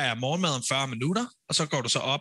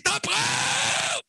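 very high, drawn-out scream; much slower than usual. Retold or imitated. Showing how contestants must announce the mail, screaming. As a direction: shriek it slowly, stretching the last word. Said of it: "så skal man bare skrige".

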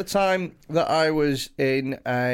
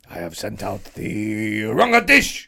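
hammed-up waitress voice; mock restaurant staff voice; 4 semitones lower, 3 dB louder than usual. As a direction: play the stiff restaurant worker apologising.

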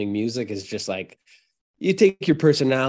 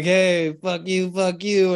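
higher-pitched, cheerful mock heckling; mock stage banter; 5 semitones higher, slower than usual. Rusty frontman retelling his banter to the crowd, mock heckling. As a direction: toss out insults as playful stage banter.

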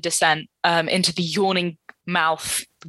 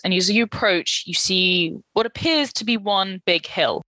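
ordinary delivery

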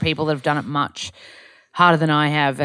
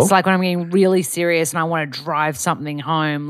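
ordinary talk, nothing marked

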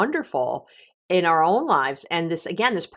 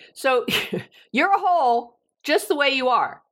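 ordinary talk, nothing marked

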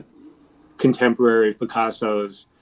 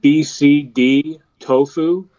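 slow, carefully spelled out restaurant name; slow and clear; 3 semitones higher, 5 dB louder, much slower than usual. Real, not acted. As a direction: repeat it slowly for a bad line. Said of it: "Say the name of the second one again".

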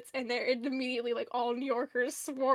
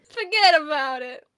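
loud mock New York accent; New Yorker accent; 8 dB louder than usual. Echoing friend's New Yorker bit, loud New York accent. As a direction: echo it as a hammed-up New Yorker. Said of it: "New Yorker? All right".

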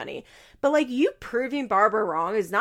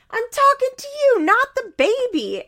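much higher, loud shrill yell; mock shriek; 6 dB louder than usual. Grandmother shrieks at the boyfriend, high and loud. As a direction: screech it at him. Barbara yelling at Nate.

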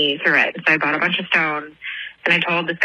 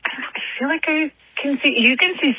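higher, strained, with the head tipped back; talking while looking up; 8 semitones higher than usual. Real, not acted. Caller gazing at the stars mid-voicemail, higher strained voice. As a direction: speak with your face turned up to the stars. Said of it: "sounded funny 'cause I was looking up at the stars".

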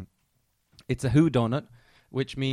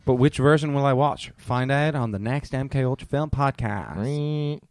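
loud, drawn-out cliffhanger teaser; hammed-up show promo voice; mock announcer; 7 dB louder than usual. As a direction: tease the next episode like an over-the-top announcer. Mock cliffhanger teaser for next episode.

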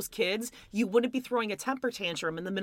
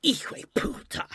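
whispered, higher mock-Spanish swear; mock Spanish curse; 5 semitones higher than usual. Mike flexing fake Spanish at Jimena, mock Spanish accent. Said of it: "So he's like".

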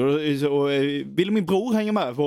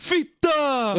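high, imitated heckler's shout; mock defiant yell; 10 semitones higher than usual. Drunk lad answering the scolding man, mock defiant yell. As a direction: yell it back cheekily, hammed-up.

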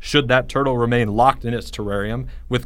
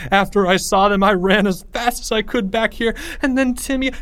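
high, panicky account; terrified; 9 semitones higher than usual. A narrator's reading. Terrified Leo recounting his escape, panicky. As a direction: blurt it in rising panic. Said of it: "saw the terror in Leo's face".

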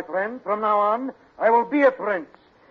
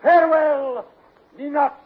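hammy romantic farewell; 6 semitones higher, 9 dB louder than usual. Acted.

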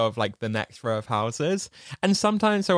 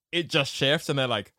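ordinary talk

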